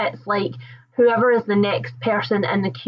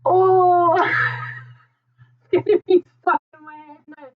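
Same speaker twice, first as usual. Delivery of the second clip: crying with laughter at the song stuck in her head; 13 semitones higher than usual. Real, not acted. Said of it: "Oh, I'm crying".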